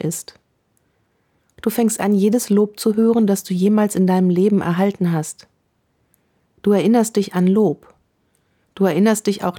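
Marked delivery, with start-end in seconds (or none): none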